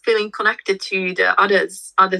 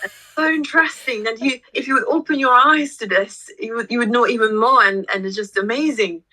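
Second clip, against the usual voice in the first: higher, eager and starry-eyed; excited pitch; 5 semitones higher than usual. Retold or imitated. Retelling what she told the skeptical partner, eager and higher. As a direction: sell the wonder to him. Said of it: "I said, like".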